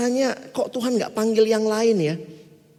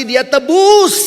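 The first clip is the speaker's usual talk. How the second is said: drawn-out preaching; 6 semitones higher, 9 dB louder, much slower than usual. Acted. Preaching, stretched phrase.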